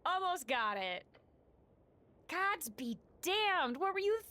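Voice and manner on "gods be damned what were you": exasperated yell, high and drawn out; Snell. A narrator's reading. Companion scolding the player after the griffin, exasperated yell. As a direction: scold at a yell, dragging out the words. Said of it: "you hear Snell yell at you".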